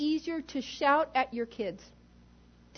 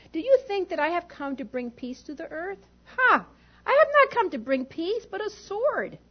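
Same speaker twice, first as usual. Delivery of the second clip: forceful quote; 4 semitones higher, 5 dB louder than usual. Retold or imitated. Quoting Jesus forcefully.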